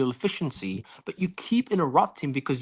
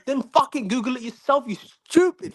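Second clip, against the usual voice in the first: angry; 7 semitones higher than usual. Real, not acted. Insult shouted mid-argument.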